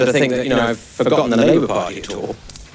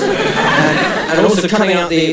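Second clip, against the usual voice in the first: usual speaking voice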